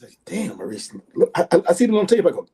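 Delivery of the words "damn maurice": soft, low amazement; retold awed reaction. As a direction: say it under your breath, impressed. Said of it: "I went like this".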